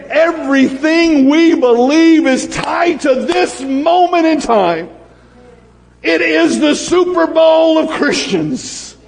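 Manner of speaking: slow, high preacher's fire; fervent preaching. Real, not acted. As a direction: hammer home the big moment. Preaching at full force.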